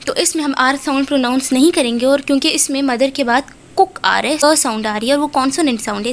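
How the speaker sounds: ordinary delivery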